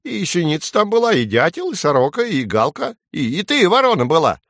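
high sparrow listing culprits; high-pitched, defensive little bird; flustered sparrow. A narrator's reading. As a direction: count them off in a high bird voice. Flustered sparrow blames the other birds.

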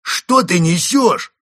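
high, loud, drawn-out bellow; roar. A narrator's reading. First wolf confronting Константин, roaring. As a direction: roar it at the liar. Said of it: "взревел первый волк".

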